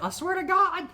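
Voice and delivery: high, threatening scold through laughter; mock angry mom. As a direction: mom threatening over laxatives. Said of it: "She was like".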